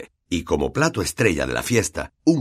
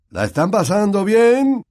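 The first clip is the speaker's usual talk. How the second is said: higher, louder hearty host; genial ghost host; 12 semitones higher, 5 dB louder than usual. Acted. Nick greeting his party guests, hearty. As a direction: welcome the guests.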